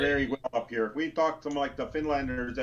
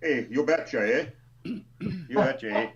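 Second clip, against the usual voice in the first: mock Canadian accent; slower than usual. Podcast friend teasing about northern talk, mock Canadian accent. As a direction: ham up a Canadian accent, stretching the words. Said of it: "Canadian talk or something".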